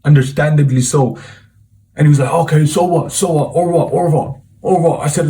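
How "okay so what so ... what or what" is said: defensive. Retold or imitated. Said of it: "of course he got defensive".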